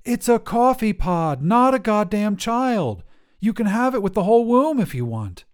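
loud, high furious yelling; angry outburst. Acted. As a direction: shout it at the hotline in disbelief. Lenore yells at baby-making hotline.

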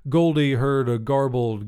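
ordinary delivery

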